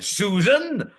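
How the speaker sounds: high, loud Doctor Who shout; shouting as William Hartnell. Retold or imitated. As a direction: bellow the name like the old Doctor. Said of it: "I shouted".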